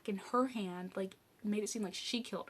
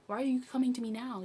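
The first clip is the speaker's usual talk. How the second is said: mildly indignant, louder and quicker challenge; accusing; 4 semitones higher, 7 dB louder, faster than usual. Retold or imitated. Sister confronting her over the email, accusing. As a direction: throw it out fast as a retold accusation.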